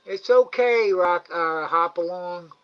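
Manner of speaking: high, loud sing-song coaxing of an animal; coaxing pet talk. Real, not acted. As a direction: coax the shy raccoon to come and eat. Coaxing the raccoon.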